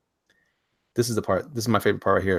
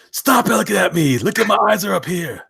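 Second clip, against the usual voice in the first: high, loud mock outrage; indignant character voice; 10 semitones higher, 5 dB louder than usual. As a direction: squeal it as the offended guy in the picture. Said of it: "This guy said".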